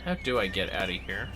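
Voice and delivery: normal talking voice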